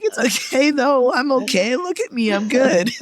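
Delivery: high, mock-tearful self-reassurance; squeaky, weepy bravado, played for laughs; retold laughing and crying. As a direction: insist you're fine through pretend tears. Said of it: "laughing and crying".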